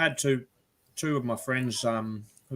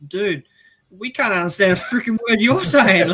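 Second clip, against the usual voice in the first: exasperated; 8 semitones higher, 8 dB louder than usual. Retold or imitated. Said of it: "I'm like".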